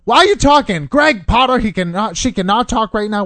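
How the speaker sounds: high, loud roommate telling-off; scolding scream. Retold or imitated. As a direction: scold them loudly, imitating an irate roommate. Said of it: "Drew's screaming at me through your phone".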